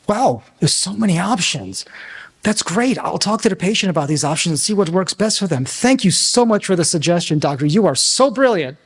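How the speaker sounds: high-pitched, wowed admiration; gushing flattery. Acted. Flattering a doctor's ego after getting the answer, gushing admiration.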